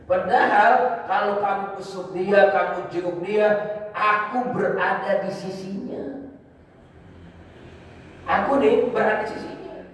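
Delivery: slow, high-pitched emphatic declaration; lightly voiced God stressing His nearness; God answering. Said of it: "Kata Allah".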